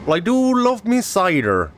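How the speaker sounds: slow, high Cornish cider-lover voice; put-on Cornish accent. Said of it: "putting on this accent".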